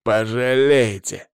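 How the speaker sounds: slow, drawn-out menace; bitter, deliberate warning; seething threat. A narrator's reading. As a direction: squeeze out each word as a threat. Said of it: "процедил Павел".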